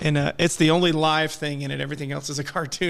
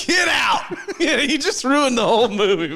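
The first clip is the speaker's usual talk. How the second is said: mock outrage; 7 semitones higher, 5 dB louder than usual.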